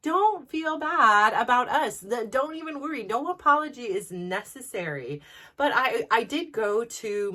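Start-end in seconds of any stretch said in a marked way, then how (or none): 0.0-5.2 reassuring him. Retold or imitated. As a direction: kindly let him off the hook, no hard feelings. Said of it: "I told him".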